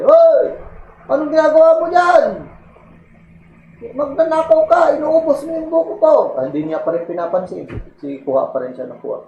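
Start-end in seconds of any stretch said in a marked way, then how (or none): 0.0-6.1 angry owner shouting. Retold or imitated. Owner yells at the coconut thief up the tree, shouting high.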